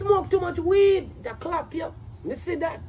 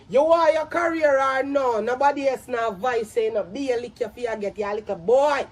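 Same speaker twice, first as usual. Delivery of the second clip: normal talking voice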